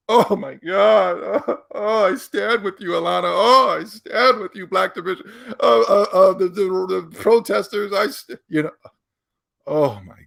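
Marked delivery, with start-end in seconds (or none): 0.1-8.7 high, whiny fake-sobbing fan voice; mock crying men. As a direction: ham up a teary supporter wailing.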